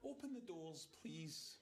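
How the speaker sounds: deliberately polite "Open the doors, please"; obliging tone to the machine; polite request. Acted. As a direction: say it nicely, as the lift asked. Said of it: "Maybe we should just say please".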